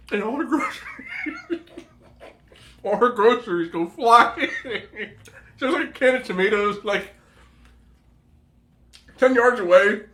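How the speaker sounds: barely holding it together; words squeezed out between laughs; laughing. Real, not acted. Laughing retelling the fall.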